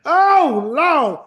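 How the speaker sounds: loud, high cry of grief; anguished wail. Acted. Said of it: "they started crying".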